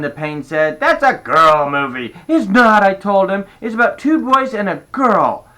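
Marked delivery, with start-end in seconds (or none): 0.8-2.1 put-on loud boyish taunt; little boy voice. A narrator's reading. Little brother teasing. 2.3-5.5 loud, high protest. A narrator's reading. Sister arguing.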